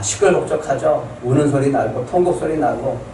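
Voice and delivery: normal talking voice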